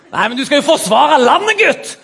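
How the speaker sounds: hammed-up military indignation; stern interrogator. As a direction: lecture the lad forcefully. Interrogator scolds young objector.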